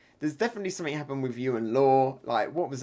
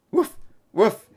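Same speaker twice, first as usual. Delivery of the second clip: higher, louder imitated woofs; dog barking; 6 semitones higher, 6 dB louder than usual. The dog answering Tama, voiced dog woofs. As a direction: bark it out like a dog. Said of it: "says the dog".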